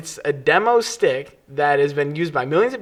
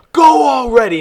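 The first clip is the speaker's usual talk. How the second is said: high, loud impatient yell; mock yell; 9 semitones higher, 7 dB louder than usual. The angry driver yelling at her to go, loud. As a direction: hurry her along loudly. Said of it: "And he's like".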